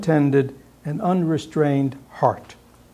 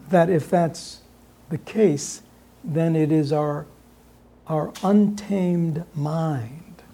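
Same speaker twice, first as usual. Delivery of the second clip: ordinary talk, nothing marked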